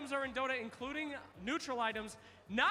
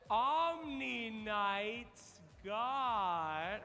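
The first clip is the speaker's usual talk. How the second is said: stretched-out repeat; 4 semitones lower, much slower than usual. Real, not acted. Host stretches the question out.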